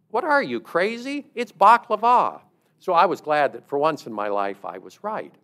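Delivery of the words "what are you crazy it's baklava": high, loud retort with "baklava" as bahk-luh-VAH; incredulous final-syllable stress. Retold or imitated. Another classmate butts in incredulously, "baklava" loudly stressed on VAH. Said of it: "another classmate joined the discussion".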